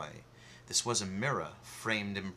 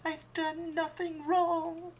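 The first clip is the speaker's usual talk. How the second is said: much higher, slow frightened plea; desperate plea. Acted. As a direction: insist on innocence in fear. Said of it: "She cried again".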